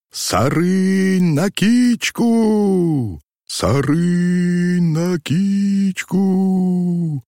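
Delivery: high, sustained far-carrying cry; booming drawn-out call. A narrator's reading. Robber band's war cry echoing through the forest, booming and drawn out. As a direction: stretch each word into a long ringing holler. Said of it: "Зычно и протяжно повторило отклик".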